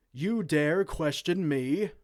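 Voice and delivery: indignant outburst. Acted. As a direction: bellow it like an insulted king. King offended by chancellor's doubt.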